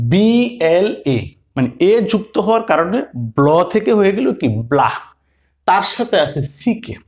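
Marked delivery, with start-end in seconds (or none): 0.1-1.2 BLA spelled letter by letter, very slow; deliberate teacher spelling. Shown as a demonstration. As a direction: draw out each letter name of BLA. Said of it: "তখন হয়ে গেল ব্লা".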